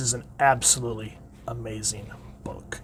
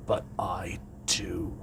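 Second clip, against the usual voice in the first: dark whisper; 6 semitones lower, 11 dB quieter, slower than usual. Acted. Guy answers Soranik in rage.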